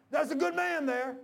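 slow, high, loud declaration; loud proclamation. Retold or imitated. Old man turns round to tell the congregation, loud proclamation. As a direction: imitate an old man announcing to the whole room.

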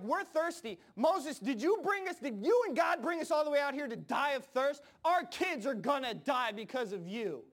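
high, indignant protest; acted-out outraged crowd; accusing complaint. People acted out accusing Moses.

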